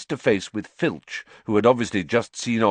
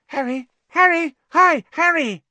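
high, loud, eager calling of his hero's name; an excited kid spotting his idol; eager young boy; 8 dB louder than usual. Acted. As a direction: Colin hailing Harry across the room, high and eager. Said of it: "It was Colin Creevey".

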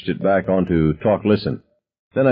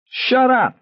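much higher screaming shout. A narrator's reading. Ted screaming at the narrator to shut up, high scream. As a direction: scream at him to stop. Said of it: "he screamed at me".

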